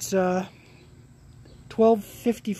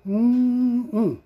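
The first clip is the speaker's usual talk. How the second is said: louder, higher drawn-out yummy hum; savoring hum; 5 semitones higher, 5 dB louder than usual. Real, not acted. Vlogger relishing a dose for the camera, savoring "mm-mm". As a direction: hum it like tasting a treat.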